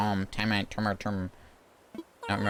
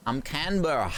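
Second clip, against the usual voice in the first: higher, rough attempt at an Australian accent; 6 semitones higher than usual. Shown as a demonstration. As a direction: try out a makeshift Australian accent. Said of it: "I'm just gonna do a rough accent".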